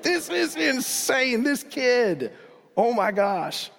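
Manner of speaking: high, slow, stunned; amazed disbelief. Retold or imitated. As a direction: awestruck onlooker who can hardly believe it. Listener amazed at the kid.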